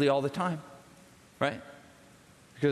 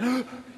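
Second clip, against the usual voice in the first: awed gasp; 7 semitones higher than usual. Retold or imitated. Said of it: "I'm like".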